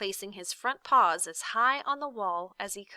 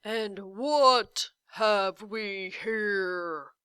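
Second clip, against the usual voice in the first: slow, breathy giant's whisper; a giant whispering over his prey; giant's menacing whisper; 5 dB louder, much slower than usual. A narrator's reading. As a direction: savour each word like a hungry giant. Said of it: "he roared".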